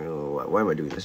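low, soft, hopeless self-question; despairing mutter. Retold or imitated. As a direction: mutter it to yourself, defeated.

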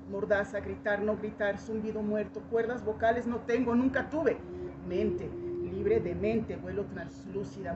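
ordinary talk, nothing marked

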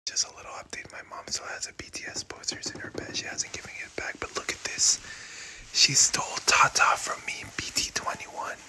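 whispered secret update, unvoiced. Real, not acted. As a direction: whisper it so mom won't hear. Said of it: "whispered update to camera".

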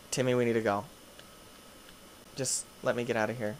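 ordinary talk

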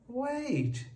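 high, loud cry to stop; alarmed, high-pitched plea. A narrator's reading. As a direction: call out urgently, pitch way up. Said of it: "cried the farmer".

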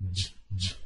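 "G, G" as two soft, low j-j bursts; G sound alone. Shown as a demonstration. Teacher isolating the G for students, short soft sounds. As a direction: say "G, G" as bare j sounds, quietly. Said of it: "Olha a diferença do D".

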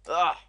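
high, loud "Ah!"; startled cry. Real, not acted. Gamer hit in the game, startled yell. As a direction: yelp as it goes wrong.

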